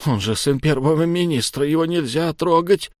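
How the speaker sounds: high, tearful protest; crying again. A narrator's reading. Captive warning against touching the minister's son, crying. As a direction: plead through tears. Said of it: "снова заплакал малой".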